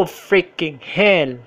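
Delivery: usual speaking voice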